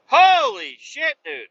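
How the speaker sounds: high, loud and slow amazed cry; loud drawn-out holy shit; stunned exclamation. Retold or imitated. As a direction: draw it out in disbelief. Hearing they went back again.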